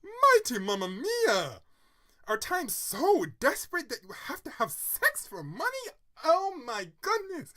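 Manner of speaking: loud, very high disgusted shrieking; drama-queen outburst. Real, not acted. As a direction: go off in theatrical horror, stretching the words. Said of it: "I'm sorry if I sound like a drama queen".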